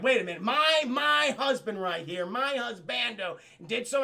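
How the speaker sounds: loud, indignant mock protest; pissed-off wife. Gargoyle's wife objecting on her husband's behalf, pissed off. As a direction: raise the voice in wifely outrage. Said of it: "his lady's pissed off".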